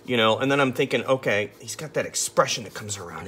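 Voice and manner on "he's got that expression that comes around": ordinary delivery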